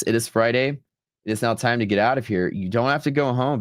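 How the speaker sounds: usual speaking voice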